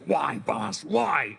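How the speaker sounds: raspy, desperate plea to his mentor; pleading Snake voice. Retold or imitated. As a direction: beg for answers, voice cracking. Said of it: "Like this".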